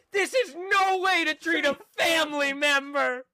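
high, slow, crying-out yell; mock outraged shout. Trace turning back to the toll man, a hammed-up high tearful shout. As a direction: wail it like a wounded family member.

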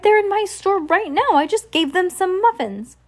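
very high, agitated shopkeeper voice; excited. A narrator's reading. Shopkeeper tells the delivery person about the kids, excited. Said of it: "the excited voice of the shopkeeper".

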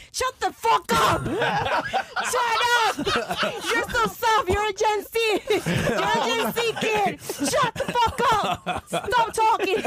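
loud, high, half-laughing shouting; laughing mock yell. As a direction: yell at the kid while cracking up. Mara yells at kid.

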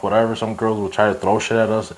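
ordinary talk

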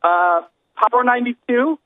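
slow, unsure answer ending as a question; mock hesitant guess at the station name; confused. Hip-hop listener asked favorite station, slow confused answer. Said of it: "it's always confusion".

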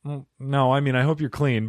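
ordinary delivery

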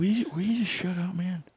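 high, put-on old man voice; mock debate retort; Biden impression. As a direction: snap at the rival. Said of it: "you just heard from President".

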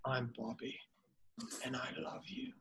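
breathy, hushed crooner line; soft whispered love line; Bobby's voice. Shown as a demonstration. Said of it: "can you still do Bobby's voices".

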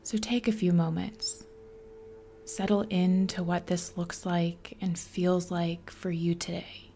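soft, whispery meditation guide with long pauses; guided meditation. Acted. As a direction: ease the listener into stillness, softly.

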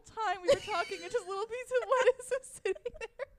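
high-pitched, barely holding in laughter; cracking up. Real, not acted. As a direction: tell it through rising giggles. Cracking up at the punchline.

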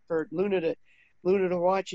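ordinary delivery

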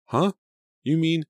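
high, sharp shocked gasp of words. A narrator's reading. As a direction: catch your breath in shock. Shocked realisation of cut brakes.

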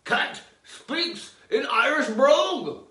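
high, loud, slow headline announce; headline read. Acted. Reading the headline aloud, slow announcer voice. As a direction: proclaim it like a tabloid banner. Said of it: "That's the headline".

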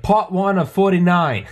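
slow, loud, high Australian announcement; Aussie Cossack. Retold or imitated. Host mimicking YouTuber's uploaded video, loud drawn-out announcement. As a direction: proclaim it like a grand series title. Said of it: "he uploaded a video".

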